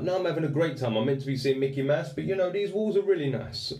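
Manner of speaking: high-pitched mock cheerfulness; exaggeratedly upbeat, dripping with irony; sarcastic retort. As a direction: pretend you are having a lovely time. Sarcastic answer to depression question.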